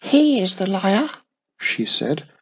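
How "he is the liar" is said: tiny witch voice. A narrator's reading.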